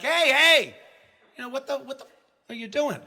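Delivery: high, loud startled shout; alarmed shout at the woman hitting him; retold alarmed yell. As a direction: yell at her to stop hitting. Being attacked, yelling at woman.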